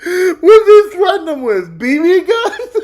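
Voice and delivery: echoing the question through laughter; laughing repeat. Real, not acted. Streamer laughing at the clip as he repeats it.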